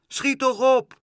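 high, loud, agitated cry; nervous shout. A narrator's reading. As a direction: shout impatiently from overhead. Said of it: "zenuwachtig boven hun hoofd vloog en schreeuwde". (zenuwachtig schreeuwen, hoog)

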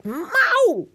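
high, loud cat yowl; loud meow. Acted. Drenched cat yowls loudly at the boy. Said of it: "Ze zegt heel hard".

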